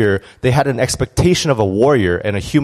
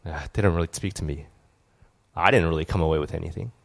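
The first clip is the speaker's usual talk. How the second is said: inner deflated voice; 6 semitones lower, 9 dB quieter, faster than usual. Retold or imitated. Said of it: "But inside you're like".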